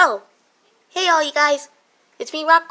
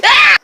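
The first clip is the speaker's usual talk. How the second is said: scream; 13 dB louder than usual. Acted. Sudden fright mid song.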